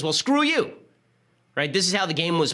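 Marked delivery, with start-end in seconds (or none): 0.0-0.7 high, louder sneering screw you; mock defiant retort. The right answering the left, mock defiant. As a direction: toss it off like a defiant partisan.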